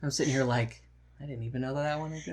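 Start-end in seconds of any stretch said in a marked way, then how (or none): 1.2-2.3 fast, hushed self-quote; quiet aside. Retold or imitated. Co-host admitting ignorance of the movie, quick quiet aside. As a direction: toss it away quickly under your voice. Voices own quiet reaction.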